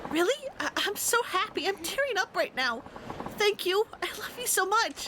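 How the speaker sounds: put-on a girlfriend close to happy tears.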